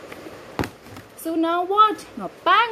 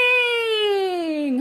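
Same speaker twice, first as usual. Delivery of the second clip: cheering shout; 3 semitones higher than usual. Acted. Cheering the sledge ride.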